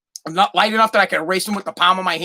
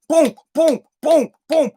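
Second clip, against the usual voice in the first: punchy booms; 5 semitones higher than usual. Acted. Comic sound effects of quick action.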